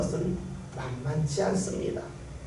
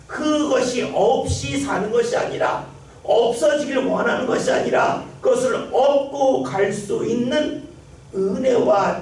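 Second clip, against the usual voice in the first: loud, rising preacher's cry; preaching at full force; 5 semitones higher, 4 dB louder than usual. Acted. Pastor urges the congregation to pray, fervent preaching. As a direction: pastor urging the congregation, louder and higher throughout.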